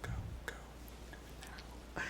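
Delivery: urgent whispered go go go; hushed egging on from the back. Retold or imitated. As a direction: whisper it from behind. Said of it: "behind the boat is going".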